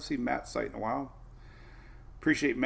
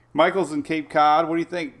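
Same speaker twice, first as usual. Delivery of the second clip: ordinary talk, nothing marked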